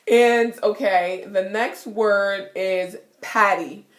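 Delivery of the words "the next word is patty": ordinary delivery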